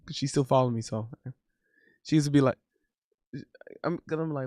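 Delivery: normal talking voice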